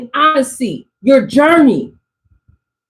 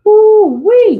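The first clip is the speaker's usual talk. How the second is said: higher, louder thrilled "ooh wee"; excited whoop; 7 semitones higher, 4 dB louder than usual. Real, not acted. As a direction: let the excitement burst out. Excited reaction to drawn card.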